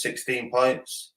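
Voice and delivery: ordinary delivery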